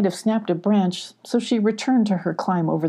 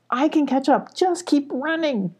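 higher-pitched self-encouragement; lightly acted higher self pep talk; urging herself on; 8 semitones higher than usual. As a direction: push yourself onward, pitch lifted. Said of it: "Molly kept repeating to herself".

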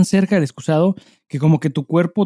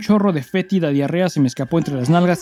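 steady storytelling read with some drama; story reading; 4 semitones higher than usual. Acted. Host reading a listener's story aloud, storytelling voice. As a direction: read it like a confession being told to listeners.

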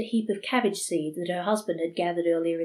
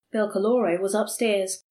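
ordinary delivery, nothing marked

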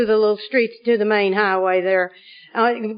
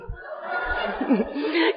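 soft wicked chuckle; 3 semitones higher, 8 dB quieter than usual. Real, not acted. Laughs after his call.

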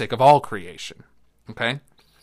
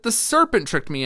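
much higher, louder as the woman; woman pleading excuse; woman's voice; 12 semitones higher, 6 dB louder than usual. Acted. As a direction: pitch up high to play the woman. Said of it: "And the woman said".

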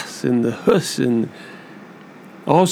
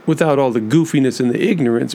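usual speaking voice

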